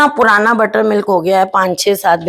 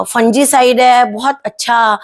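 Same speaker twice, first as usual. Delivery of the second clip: usual speaking voice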